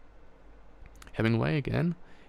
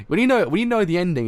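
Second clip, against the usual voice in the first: much higher and louder, near a wail; emotional outburst; 8 semitones higher, 10 dB louder than usual. Real, not acted. Reader crying out to viewers about the ending, overwhelmed.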